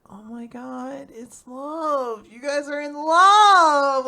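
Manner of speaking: slow, high swoon swelling to a loud cry; gushing. Retold or imitated. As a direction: draw it out, building to a big finish. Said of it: "she's like".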